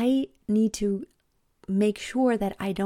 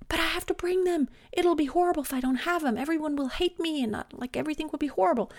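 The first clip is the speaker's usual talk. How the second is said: put-on much higher, whiny pleading kid voice; whiny child; 8 semitones higher, faster than usual. Mom imitating her daughter's frantic plea, high whiny voice. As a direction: mimic a panicking ten-year-old begging.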